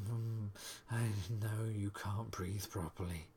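ordinary delivery

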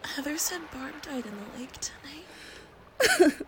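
slow eerie whisper; spooky whisper. Acted. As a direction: whisper it like a ghost story. Spooky ghost talk.

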